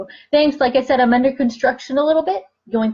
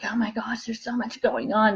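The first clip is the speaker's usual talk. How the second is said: mock whisper; 6 dB quieter than usual. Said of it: "numerologists were like".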